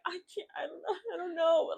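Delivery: high, cracking voice on the edge of tears; overwhelmed wife answering her husband, near tears; breaking down. Retold or imitated. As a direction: let the voice go up and break like you're about to cry. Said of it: "I just broke down".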